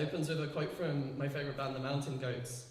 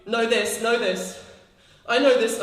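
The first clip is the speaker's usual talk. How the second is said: much louder, pushed hard; loud insistence; 5 semitones higher, 9 dB louder than usual. Pretend. Speaker answering family, loud insistence. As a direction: insist on it, almost shouting.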